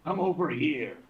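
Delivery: acted hostage-taker's demand; threatening taunt. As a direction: call the enemy over as a threat. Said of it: "look right at the goddess and be like".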